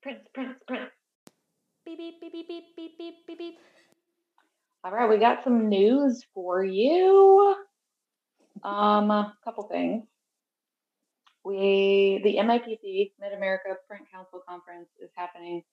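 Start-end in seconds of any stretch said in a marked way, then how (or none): 1.8-3.6 high, soft monotone beeping; imitated news beeps. Co-host announces the news segment, imitated beeps. 4.8-12.6 loud, slow, drawn-out rising intro; mock news announcement. Co-host opens the news segment, showy announcer call.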